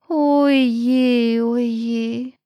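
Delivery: long, drawn-out woeful sigh; sighing snail. A narrator's reading. Snail sighs over all that studying, woeful. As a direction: sigh each word out slowly. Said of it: "seufzte die Schnecke".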